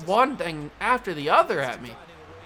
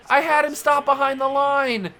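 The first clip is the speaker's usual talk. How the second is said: frustrated; 7 semitones higher, 3 dB louder than usual. Real, not acted. Repeats complaint after outburst.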